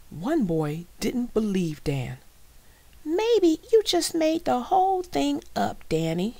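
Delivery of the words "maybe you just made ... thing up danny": put-on high, loud taunting kid; loud schoolboy challenge, pitched way up; doubting boy. A narrator's reading. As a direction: needle him in a high boy's voice.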